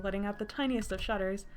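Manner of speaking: ordinary delivery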